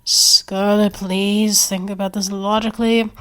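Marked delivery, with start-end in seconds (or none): none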